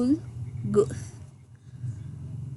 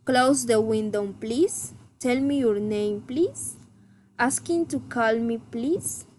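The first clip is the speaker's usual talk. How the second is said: requests that end on an upswept "please"; rising intonation. Shown as a demonstration. Teacher models requests, rising intonation on "please". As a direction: swing each "please" upwards. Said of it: "Request rising intonation".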